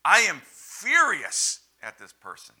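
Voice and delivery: imitating a heated complaint about a teammate; Tony Robbins fuming. Tony repeating his phrase in a team meeting, mock-angry and loud. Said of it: "Tony kept using the phrase".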